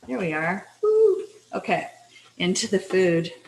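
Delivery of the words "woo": high loud cheer woo. Real, not acted. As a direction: let out a quick yelp. Cheer while fixing camera.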